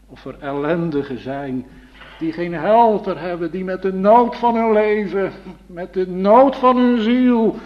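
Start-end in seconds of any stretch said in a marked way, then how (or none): 2.2-7.6 building fervor. Acted. Preacher crescendo about troubled souls.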